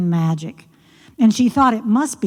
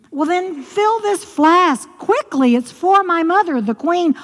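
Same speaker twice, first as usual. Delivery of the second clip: impatient princess; 9 semitones higher than usual. Acted. Daughter demands.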